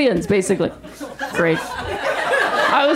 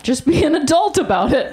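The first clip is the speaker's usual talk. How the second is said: usual speaking voice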